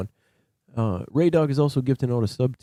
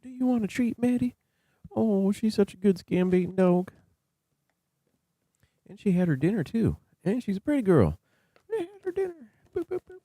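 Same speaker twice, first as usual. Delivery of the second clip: high cooing voice for a beloved dog; 12 semitones higher than usual. Real, not acted. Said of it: "I'll just sit here and baby talk Maddie".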